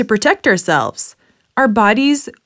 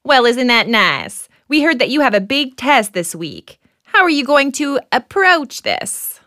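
game show host; 4 semitones higher than usual. Acted.

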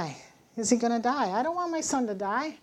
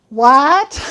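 loud startled what with a laugh; astonished what; 4 semitones higher, 15 dB louder than usual. Retold or imitated. Mother stunned by God's reply, loud laughing disbelief. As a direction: blurt it out in disbelief.

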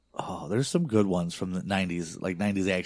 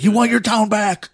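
shouted much higher and louder, a mock movie threat; hammed-up tough guy bark, pitched way up; menacing movie tough guy; 13 semitones higher, 9 dB louder than usual. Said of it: "He's like".

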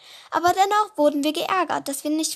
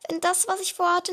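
ordinary delivery

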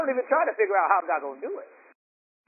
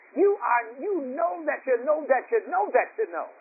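high, driving pulpit cadence, each phrase end lifted; full-on preaching; 10 semitones higher than usual. Real, not acted. Preacher urging the congregation to be convinced, full-on preaching. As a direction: build it clause by clause like a preacher. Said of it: "I feel like preaching right now".

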